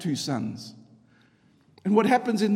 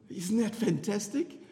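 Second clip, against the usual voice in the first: ordinary delivery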